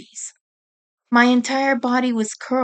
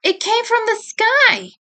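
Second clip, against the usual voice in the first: much higher, eager answer; piping up; 10 semitones higher than usual. A narrator's reading. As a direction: chirp it in brightly. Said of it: "Monaco piped up".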